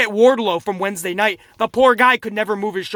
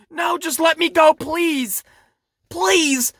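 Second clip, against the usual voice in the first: higher-pitched imitation of pleading; mock begging; 6 semitones higher, slower than usual. Reviewer imitating a wrestler to the ref, mock begging. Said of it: "Aaliyah's basically begging him".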